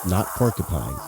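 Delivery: ordinary delivery, nothing marked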